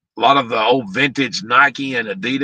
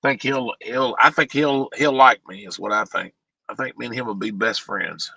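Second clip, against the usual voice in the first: sarcastic. Real, not acted. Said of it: "Yeah, that's sarcasm".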